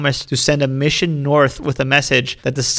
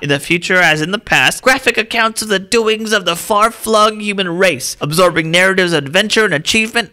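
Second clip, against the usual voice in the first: put-on old-timey voice; 7 semitones higher than usual. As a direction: read like a dying old-timey man. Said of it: "old-timey voice or dying old man voice".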